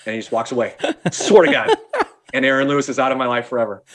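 normal talking voice

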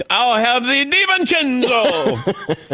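high, slow, laughing sing-song order; mock restaurant order. Host pretends to order the player at a restaurant, laughing. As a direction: order the dish like a fancy entrée. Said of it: "go into a restaurant and say".

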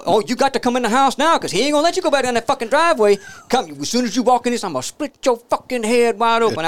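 fast, high-pitched, loud scolding; acted threatening mama. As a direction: rattle off a mother's threats at full yell. Voices mama threatening her son.

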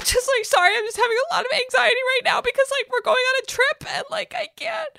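high, fast, hammed-up panicky voice; mock a near-tearful meltdown, words tumbling out; frantic anxiety. Uptight girlfriend apologising before a trip, frantically.

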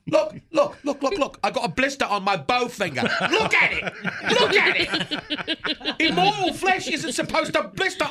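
high, pushy whining; whiny insistence. Acted. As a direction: demand they look, rising each time. Showing off a blister.

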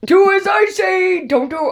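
high, loud laughing shout. Real, not acted. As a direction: belt it out while cracking up. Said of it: "I messed that up".

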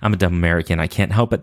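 normal talking voice

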